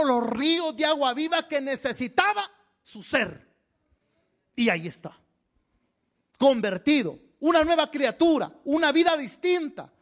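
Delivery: high, ringing sermon climax; fervent preaching. Real, not acted. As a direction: drive each phrase home to the congregation. Preaches at full force.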